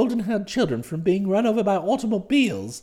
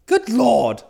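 startled, louder exclamation; agitated; 5 dB louder than usual. A narrator's reading. Bertie addresses his valet about the club book, agitated. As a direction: burst out with an agitated oath. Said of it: "had quite a bit of agitation".

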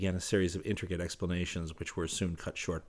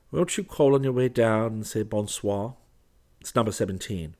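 a touch of shyness, opening higher; timid request; 4 semitones higher, 8 dB louder than usual. A narrator's reading. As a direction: ask a favour a little shyly. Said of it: "he added timidly".

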